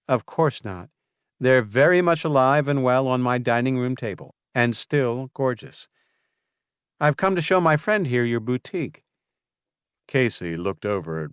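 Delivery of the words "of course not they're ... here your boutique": a touch higher, louder friendly woman; a hint of the mayor's cheer; mayor answering the florist brightly; lightly voiced Marley. A narrator's reading. Marley's character voice.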